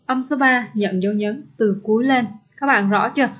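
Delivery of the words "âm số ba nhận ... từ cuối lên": normal talking voice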